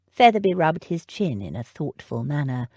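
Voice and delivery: ordinary delivery, nothing marked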